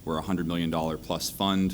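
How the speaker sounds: ordinary delivery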